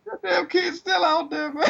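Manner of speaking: mock crying. Mock mourning a meme kid.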